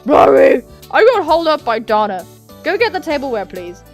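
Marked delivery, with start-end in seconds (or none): 0.0-2.2 loud, raised character voice; high, loud acted reply; called-back apology. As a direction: shout the excuse to the next room. Ben calling back to sisters.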